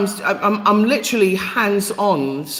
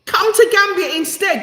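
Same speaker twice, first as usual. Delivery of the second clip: much higher, emphatic punchline; excited high; 12 semitones higher than usual. Real, not acted. Vlogger answering the visa fee with defiant excitement. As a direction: fling the solution out with heat. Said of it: "That's what I got to say".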